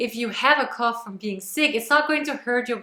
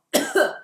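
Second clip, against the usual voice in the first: short sharp cough; 2 semitones higher, 3 dB louder than usual. Shown as a demonstration. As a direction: give one sharp cough. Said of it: "blows them apart in this cough".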